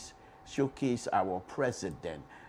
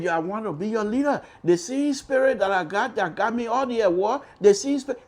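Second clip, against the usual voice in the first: higher, quick, eager pitch; eager candidate; 11 semitones higher, 7 dB louder than usual. Retold or imitated. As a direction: sell yourself with zeal. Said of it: "He said".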